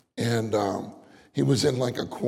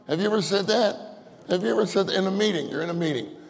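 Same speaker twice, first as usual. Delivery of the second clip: ordinary talk, nothing marked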